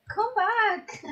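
loud call after them; shouting. Real, not acted. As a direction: yell come back at them. Shouting after someone in game.

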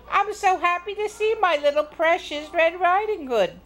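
high, gushing grandmother; doting Grandma. Acted. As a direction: fuss over a precious grandchild. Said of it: "said Grandma".

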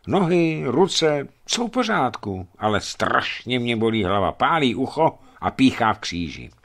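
usual speaking voice

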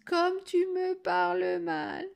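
very high, drawn-out granny wail; mock tearful whine. Girl expects grandmother's tears, mock crying complaint. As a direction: imitate a grandmother about to cry. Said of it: "se mette à pleurer et lui dise".

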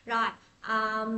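ordinary talk

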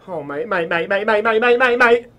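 escalating alarmed chant; rising panic. Real, not acted. Player sees danger coming, panic mounting with each word. Panicking as something approaches in game.

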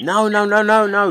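high, insistent string of refusals; rapid-fire objection, pitched well up; emphatic objection. Real, not acted. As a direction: cut in and flatly contradict. Cutting in to contradict the agent.

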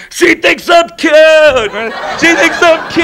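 congested cartoon reindeer; stuffed-nose voice. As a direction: crow it happily as if you have a cold. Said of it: "it sounds like he has a cold".

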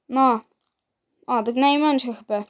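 ordinary talk

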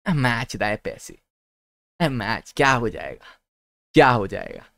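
slow, drawn-out mock indifference; acted reluctance. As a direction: drag the words out as if unmotivated. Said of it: "मतलब ऐसा लगता है भाई कि".

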